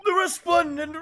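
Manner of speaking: put-on loud, high excited old man. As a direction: shout with joy like a grandpa. Old man's excited outburst.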